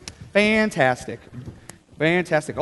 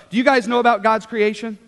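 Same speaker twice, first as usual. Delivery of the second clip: normal talking voice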